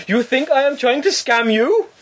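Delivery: high, slow, incredulous imitation; indignant scammer. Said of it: "And he went".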